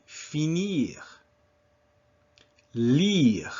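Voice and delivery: finir, lire with a long ee in the last vowel; drawn-out ee and French r in finir, lire; French pronunciation model. Shown as a demonstration. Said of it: "Répétez".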